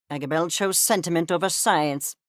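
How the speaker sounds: ordinary delivery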